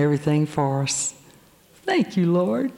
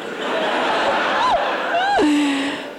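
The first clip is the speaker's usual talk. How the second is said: big laugh. Real, not acted. Speaker laughing at her own punchline to the congregation, real burst of laughter. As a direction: crack up at her own joke.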